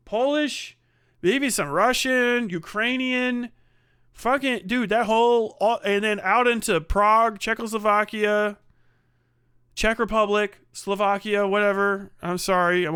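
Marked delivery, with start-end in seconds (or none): none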